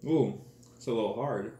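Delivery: crunchy bite between words; talking while chewing. Real, not acted. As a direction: mouth partly full of noodles. Seen 0: Chews bitten dry noodle block while speaking, holding it to mouth.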